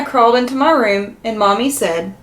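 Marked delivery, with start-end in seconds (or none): none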